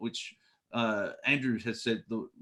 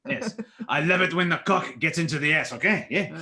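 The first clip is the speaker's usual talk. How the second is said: loud hammed-up French accent; a French tourist ordering, overacted and loud; mock French accent, louder; 5 semitones higher, 6 dB louder than usual. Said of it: "in a French accent".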